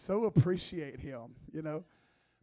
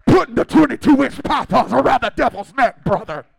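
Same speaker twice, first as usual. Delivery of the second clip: Pastor Joe; 3 semitones higher, 14 dB louder than usual. Acted. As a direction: roar it like a ring hype man. Reprises the Pastor Joe impression.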